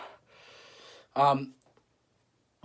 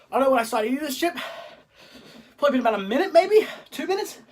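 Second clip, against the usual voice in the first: strained, in pain; 5 semitones higher, 3 dB louder than usual. Real, not acted. Talking through burning chip.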